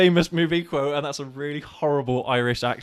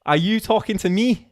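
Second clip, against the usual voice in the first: higher Northern Irish attempt landing in Scouse; botched Northern Irish accent; 6 semitones higher, 3 dB louder than usual. Player retrying the film quote, Northern Irish accent gone Scouse. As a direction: aim for Belfast, slip into Scouse, rising end. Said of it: "No, that sounds like Scouse".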